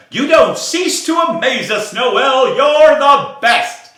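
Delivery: high, loud praise, slowing on the last words; loud admiring birds, stretched finish; impressed birds. Acted. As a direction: cheer her on with admiration. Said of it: "now completely impressed".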